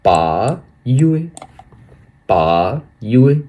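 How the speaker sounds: पायुए drawn out slowly, rising at the end; slow model syllables. Shown as a demonstration. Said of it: "अगस्त को हम लोग बोलेंगे". (पायुए धीरे धीरे बोलना)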